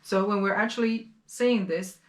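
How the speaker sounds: ordinary talk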